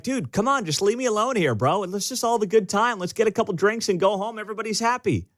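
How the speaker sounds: a hint of a nervous guy pleading to be left alone. Pleading victim in bar story.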